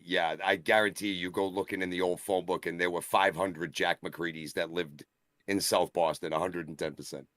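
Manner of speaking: ordinary talk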